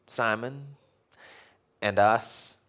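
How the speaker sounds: normal talking voice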